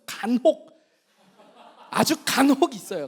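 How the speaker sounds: real laugh through the words. Real, not acted.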